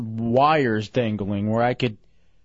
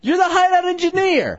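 shouting; 5 dB louder than usual. Real, not acted. Arguing with engineer.

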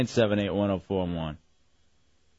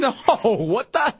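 slow, laughing disbelief; 8 semitones higher, much slower than usual. Real, not acted. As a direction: laugh it off, spooked. Laughing at creepy caller.

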